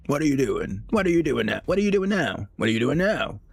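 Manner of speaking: imitating an overbearing parent asking what you're doing; nagging parent. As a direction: needle them over and over like a hovering parent. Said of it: "parents are just on your ass".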